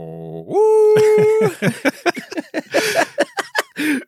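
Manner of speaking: very high sustained "ooo"; comic falsetto howl dissolving into laughter; piercingly shrill note. Acted. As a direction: swoop up to a shrill wail and hold it. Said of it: "is now piercingly shrill".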